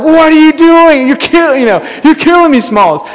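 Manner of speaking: high-pitched mock-angry parent; exasperated outburst. Dad walking in on the kids' mess, exasperated. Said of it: "this thing, like, was consuming me".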